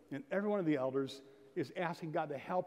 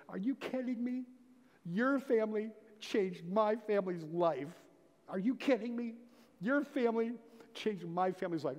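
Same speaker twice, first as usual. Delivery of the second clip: lightly voiced, higher incredulous exclaim; amazed gratitude; 6 semitones higher than usual. [name] telling the pastor, a touch of amazed gratitude. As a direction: repeat it slowly, marvelling. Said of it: "that little voice going".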